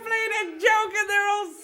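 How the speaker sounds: high, smug, giggling boast; gloating laugh. A narrator's reading. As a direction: crow about fooling them. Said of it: "the boy laughed and laughed".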